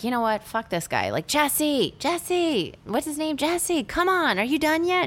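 screaming for Jesse. Retold or imitated. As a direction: yell his name from inside the car. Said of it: "trying to scream from within the car".

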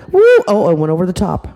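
plain reading voice

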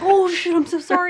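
high, loud imitation of an apologizing friend; mock panicked apology. Friend who backed his car into her, mock frantic apology. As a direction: blurt it as the flustered driver.

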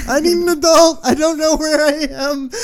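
recalling being high and lost, mock panicked. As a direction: play the lost stoned kid in panic. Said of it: "start having a panic attack".

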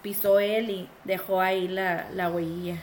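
ordinary talk, nothing marked

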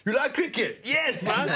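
high, eager imitated Pakistani accent; Pakistani cab driver. Player imitates cab drivers offering free rides, keen Pakistani accent. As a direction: greet a passenger keenly, Pakistani accent, pitched high. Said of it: "All the Pakistani cab drivers".

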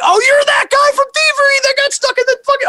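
very high mock-fan voice; excited recognition. Crew member recognizing the guy from Thievery, excited imitation. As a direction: imitate someone amazed, way up high.

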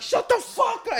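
retold furious yell; angry yell. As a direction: snap at the screaming kid. Yelling at crying stepson.

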